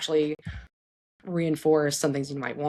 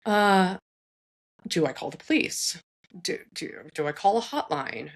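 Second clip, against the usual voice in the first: usual speaking voice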